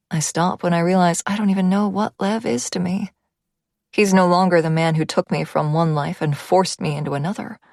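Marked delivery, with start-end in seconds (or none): none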